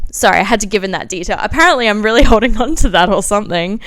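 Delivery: loud, laughing apology; laughing at herself. Real, not acted. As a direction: laugh through the admission.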